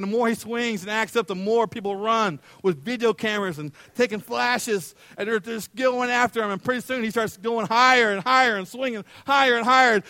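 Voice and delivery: normal talking voice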